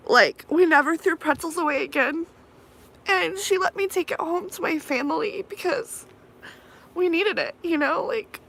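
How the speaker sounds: holding back tears; teary. Real, not acted. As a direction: voice rising and tight with tears. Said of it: "I'm getting teary".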